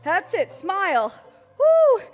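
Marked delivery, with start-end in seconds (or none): 1.6-2.0 high, loud, joyful whoop; excited whoop. Real, not acted. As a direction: whoop to pump up the class. Coach cheering after the exercise.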